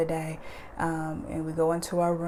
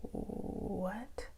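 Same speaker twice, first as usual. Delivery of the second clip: breathless disbelief, hushed; can't believe what mom said; stunned whisper; 2 semitones higher, 11 dB quieter, much slower than usual. Retold or imitated. A child hearing mom dismiss them, stunned whisper.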